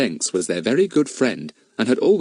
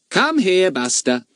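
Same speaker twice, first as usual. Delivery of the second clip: extremely polite; 10 semitones higher than usual. Acted. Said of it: "in an extremely polite voice".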